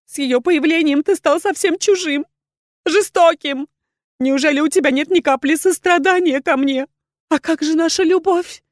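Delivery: high, loud, sobbing plea; wounded woman pleading through tears; tearful reproach. A narrator's reading. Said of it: "села, поджимая под себя ноги и всхлипывая".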